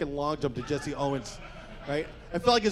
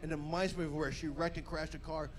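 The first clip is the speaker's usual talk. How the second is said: ordinary delivery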